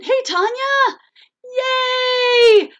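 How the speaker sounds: high, loud squealed cheer; excited greeting. Real, not acted. Host spots a viewer arriving, excited shouted greeting. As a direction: shout hello with delight, stretching the cheer.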